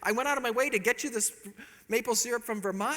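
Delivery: sweetly proud, totally clueless; innocent husband. Retold or imitated. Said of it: "I go".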